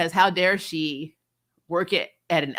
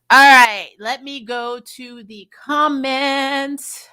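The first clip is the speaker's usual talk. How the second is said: higher, very slow and stretched; drawn-out announcement; 5 semitones higher, 8 dB louder, slower than usual. Real, not acted. Host telling viewers she's turning to comments, drawn-out announcement. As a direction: stretch out each word at a raised pitch.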